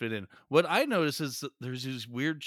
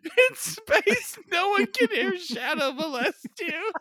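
much higher, laughing through words; squealing with laughter; 3 dB louder, slower than usual. Real, not acted. Tagline redone while laughing.

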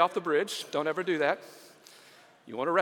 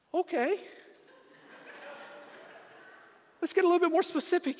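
high, careful, probing voice; high, pointed questioning; 5 semitones higher, 3 dB quieter than usual. Acted. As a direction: narrow in, testing him. Rescuer probing more specifically.

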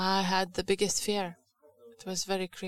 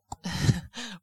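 short laugh; 4 dB louder than usual. Real, not acted. Laughing in sermon.

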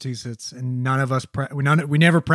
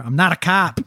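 mock Boston accent; Boston movie-star impression; 3 semitones higher, 3 dB louder than usual. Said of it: "that's all I got".

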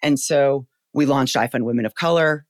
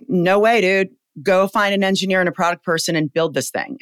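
loud; 6 semitones higher, 4 dB louder than usual. Retold or imitated. Retold rallying order to colleague.

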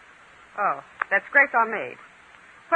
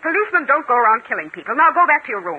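loud, high, commanding; stern order; 6 semitones higher, 8 dB louder than usual. Acted. Mona orders Lucy back to her room, loud and stern. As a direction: send her off with a raised voice.

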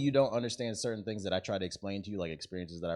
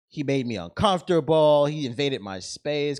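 loud, high, drawn-out mock grievance; hammed-up victim voice, slow and raised; mock complaint; 6 semitones higher, 9 dB louder, slower than usual. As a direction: drag out an exaggerated complaint. Mocking an exaggerated online complaint.

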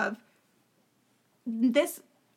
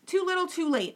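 dismissive quote; 6 semitones higher than usual. Retold or imitated. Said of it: "she's like".

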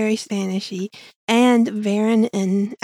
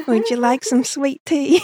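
higher-pitched mock Southern accent; Southern drawl; 5 semitones higher, 4 dB louder than usual. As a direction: play up a Southern hostess drawl. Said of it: "The very Southern drawl".

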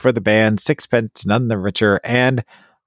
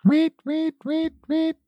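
very high, thin squeak; squeaky high voice; 4 dB quieter, slower than usual. Acted.

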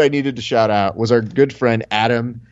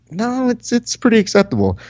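much higher, breezy contradiction; mock reassurance; 10 semitones higher than usual. Answering John about the late tournament, a high breezy brush-off. Retold reply to angry John.

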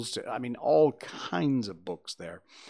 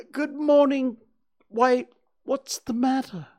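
much higher and louder bright little bear; cheerful Pooh; 12 semitones higher, 6 dB louder than usual. A narrator's reading. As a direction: chirp it out like a friendly bear. Pooh asks Eeyore.

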